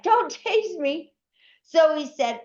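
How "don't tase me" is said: mock pleading. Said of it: "He was like".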